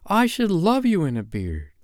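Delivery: put-on high, sweet girlish reassurance; comforting. A narrator's reading. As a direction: console a friend fondly as a young girl. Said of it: "said Wendy the comforter".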